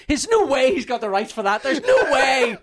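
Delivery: loud, high laughing rant; indignant. Retold or imitated. Ranting to friends about the rights, loud indignant laughing. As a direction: insist over and over in disbelief.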